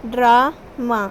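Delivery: Drama said loud with both syllables marked; syllable demo. Shown as a demonstration. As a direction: tap out each beat of drama. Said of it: "drama में हमें टू beat सुनाई देती हैं".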